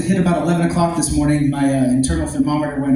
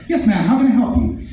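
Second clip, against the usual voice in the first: higher, polished call-centre greeting; customer service voice; 5 semitones higher than usual. Retold or imitated. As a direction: sweeten it up like a phone rep. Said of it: "put on your best customer service voice".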